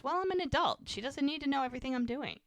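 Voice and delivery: high-pitched, defensive retort; acted-out huffy sister; indignant protest. Sister protests in retold sibling argument.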